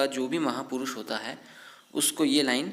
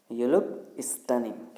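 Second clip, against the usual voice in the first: very slow, word by word, spelled out for learners; slow teaching read; much slower than usual. Shown as a demonstration. English teacher dictates the sentence slowly to learners. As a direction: stretch each word like dictation. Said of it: "इस sentence को हम कैसे बोलेंगे".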